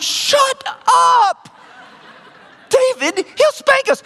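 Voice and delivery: high mock-desperate yell; panicked kid shouting. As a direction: shout at a brother to stop telling. Panicked kid silencing his brother.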